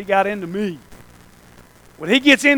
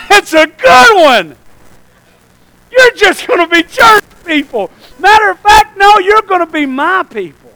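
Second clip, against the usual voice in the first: high, loud mock amazement from on high; laugh, then proclaim it loudly; God amused, then overruling; 11 semitones higher, 15 dB louder than usual. God answers the churchgoer's modest plans, loud amused retort. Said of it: "God's like".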